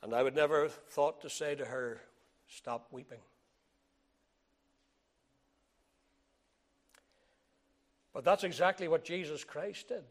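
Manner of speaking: soft, low, slowed telling; hushed storytelling. Acted. Pastor quietly recalling the weeping widow, hushed. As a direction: drop to a near murmur.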